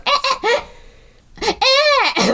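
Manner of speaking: high, chopped-up mock baby wail; imitated baby cry. Mimicking looped baby audio that stops, mock crying. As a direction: fake infant cries that keep cutting off. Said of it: "you hear the baby crying".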